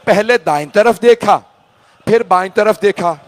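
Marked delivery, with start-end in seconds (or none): none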